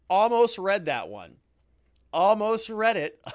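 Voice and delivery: nearly fell for it, call it out loud; drawn-out, loud cry of a near miss; outburst at a near miss. Real, not acted. Catches a prank name almost read.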